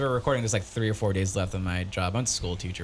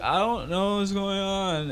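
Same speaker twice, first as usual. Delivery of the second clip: high, drawn-out mock-crying wail; near tears; 10 semitones higher, slower than usual. Lost in his own dream, practically crying. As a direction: whine it slowly as if practically crying. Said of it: "I'm practically crying".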